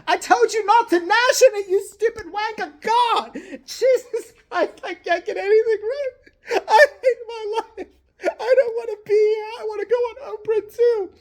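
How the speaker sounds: high, weepy posh whimper; hammed-up crybaby royal; whiny prince. William whining after being scolded, high and weepy.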